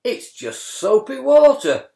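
high loud indignant huff. Acted. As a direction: protest it indignantly. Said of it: "Brian said in a huff".

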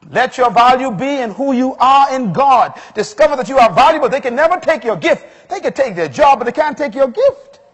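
loud, high, rousing preacher voice; impassioned sermon peak; full-force preaching. Real, not acted. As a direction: drive it home to the congregation.

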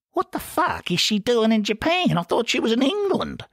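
a rough larrikin questioning his brothers; Ferret's voice. A narrator's reading.

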